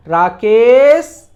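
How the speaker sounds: loud, very drawn-out hail; long, rising call with the weight on के; calling from afar. Shown as a demonstration. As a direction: shout Rakesh so a distant person hears it. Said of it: "ये बोलेंगे".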